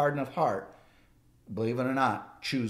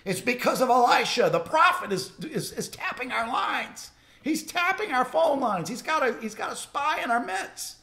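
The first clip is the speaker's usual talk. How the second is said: fired-up king; 12 semitones higher than usual. Retold or imitated. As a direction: rant like a king who's been spied on. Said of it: "the Syrian king got all fired up".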